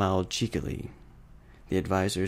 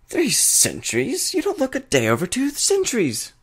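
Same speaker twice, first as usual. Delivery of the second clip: much higher, louder, hammed-up mock surprise; flattering trickster; 5 dB louder than usual. A narrator's reading. Discord flatters the princess about her kingdom's age, hammed up. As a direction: pour on the flattery theatrically.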